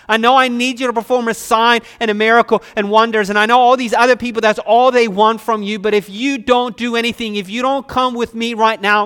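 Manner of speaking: loud, high, urgent acted plea; desperate pleading. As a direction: plead loudly as a desperate father. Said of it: "hear the desperation in this man's voice".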